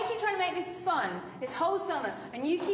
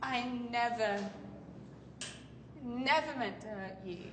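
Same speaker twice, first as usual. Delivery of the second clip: low, quiet, halting apology; subdued apology; 6 semitones lower, 6 dB quieter than usual. Acted. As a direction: apologise softly, pausing after the false start. Quiet apology after the friend's tirade.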